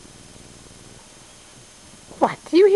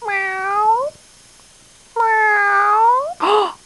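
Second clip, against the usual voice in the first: imitated meow; 6 semitones higher than usual. Mimics cat to excite dog.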